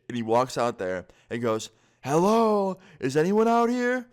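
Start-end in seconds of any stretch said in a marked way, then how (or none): 2.0-4.1 loud, high call into the dark yard. Retold or imitated. Friend stepping outside, calling into the dark backyard. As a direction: shout it out into the night.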